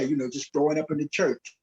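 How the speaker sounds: ordinary talk, nothing marked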